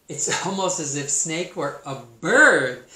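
loud, delighted admiration with a laugh; admiring. Acted. Emu admiring the singing snake, loudly delighted. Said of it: "said Emu admiringly".

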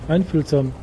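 ordinary delivery

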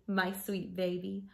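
plain reading voice

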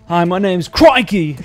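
slow, lightly Australian self-introduction; a light touch of an Australian accent on Crikey; mock Australian accent. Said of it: "you have to say Crikey in an Australian accent".

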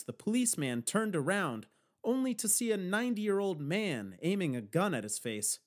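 ordinary delivery, nothing marked

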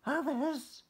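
high eager little-fish cry; Stanley hears of his friends, high cry. Acted. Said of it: "cried Stanley".

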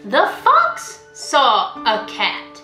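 usual speaking voice

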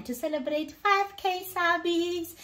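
high-pitched, sing-out cheer; excited celebration. Real, not acted. Vlogger going to breakfast to celebrate subscribers, excited.

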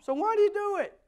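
high-pitched exasperated demand; exasperated shout. Acted. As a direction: shout the question like a punchline. Loud punchline to radio ad.

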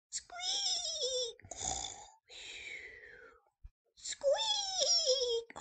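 tiny high squeaking; mouse squeak. Acted.

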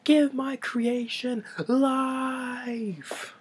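very high, very slow dramatic howl; mad scientist's cry. Retold or imitated. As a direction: bellow it to the heavens like Frankenstein. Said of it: "classic with Gene Wilder, it's like".

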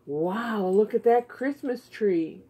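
ordinary talk, nothing marked

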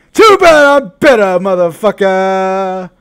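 high, loud, drawn-out gloat; gloating yell. Real, not acted. Streamer gloating at the rival he just beat, loud yelled gloat. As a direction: crow it over the beaten rival. Trash-talking opponent after win.

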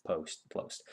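quick hushed, whispery practice of a fumbled word; barely voiced, to yourself; muttered self-correction. Real, not acted. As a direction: rehearse the word under your breath.

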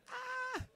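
a high burst of a squeal; excited squeal. Real, not acted. Host squealing to viewers about the show. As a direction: let out a happy shriek.

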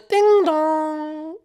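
high sung doorbell chime; imitated doorbell. Doorbell announcing the guest's arrival, high chime. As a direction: sing out a doorbell, high and drawn out.

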